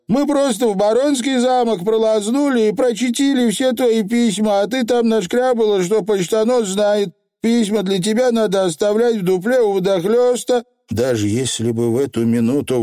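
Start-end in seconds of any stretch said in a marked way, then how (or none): 0.1-10.6 high, chattering dialect voice; confessing Feegle. A narrator's reading. Feegle admitting to reading letters, high and guileless. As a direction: spill it all in a little rogue's patter.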